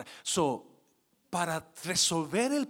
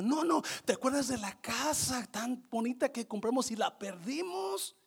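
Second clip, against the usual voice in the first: building husband; 9 semitones higher, 9 dB louder than usual. Retold or imitated. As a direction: build toward the joke. Dying husband building to punchline.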